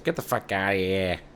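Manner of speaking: lightly put-on Italian-American accent. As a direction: say it with a hint of Italian wiseguy accent. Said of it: "I gave Canada an Italian accent".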